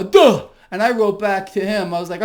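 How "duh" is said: scornful duh. Real, not acted. Mocking retort.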